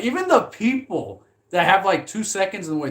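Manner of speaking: usual speaking voice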